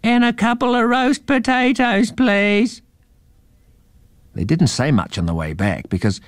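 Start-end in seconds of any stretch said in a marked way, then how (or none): 0.0-2.8 very high mouse call; a high little mouse voice calling after them. Acted. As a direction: shout after the running kids. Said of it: "the mouse call out".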